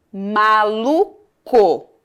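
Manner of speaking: MALUCO very slow, with CO set apart; syllable by syllable. Shown as a demonstration. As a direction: stretch MA-LU, then a separate CO. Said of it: "Olha a sílaba final".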